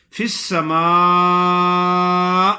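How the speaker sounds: long smooth madd, no jolt at the end; correct recitation. Shown as a demonstration. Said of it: "اے کا جھٹکا نہیں دینا".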